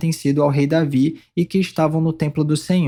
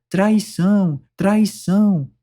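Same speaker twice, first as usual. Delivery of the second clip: restrained narrated cry of alarm; mildly raised, higher-pitched exclamation; outraged cry; 3 semitones higher, slower than usual. A narrator's reading. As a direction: cry out the accusation without really shouting. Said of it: "rasgou suas vestes e gritou".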